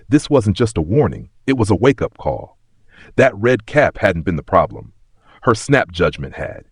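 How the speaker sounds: ordinary delivery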